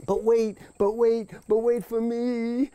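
high, anxious calling after the dog; high pleading call. Acted. Rabbit calling after the departing dog, high and pleading. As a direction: cry out high and desperate, wait for me.

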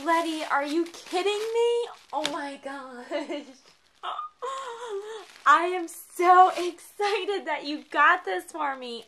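overjoyed, stretching every word; real excitement. Real, not acted. Opens long-wanted gift.

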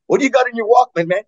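put-on high, loud, fast taunting kid; mock bully. Stubborn metalhead demands to see the Walkman, mock bully. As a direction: needle the kid about his tape.

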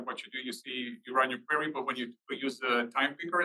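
normal talking voice

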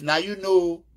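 ordinary delivery

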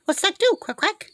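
high-pitched pet-talk prompt; playful prompting. Real, not acted. Owner coaxing a bird to quack, high playful voice.

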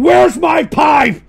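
exasperated shout. Real, not acted. Streamer shouting for his pipe, exasperated. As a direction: bellow for the pipe.

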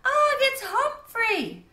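high, loud, slow call; calling across the lake; shrieking call. A narrator's reading. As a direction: draw the name out loud and high. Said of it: "I shrieked".

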